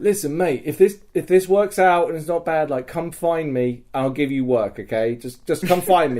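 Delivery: urgent earnest offer. Retold or imitated. Earnest offer to departing prisoner.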